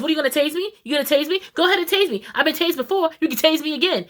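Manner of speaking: high, goading dare; defiant taunt. Retold or imitated. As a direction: taunt the cops to do it. Kelly defiantly taunting police officers.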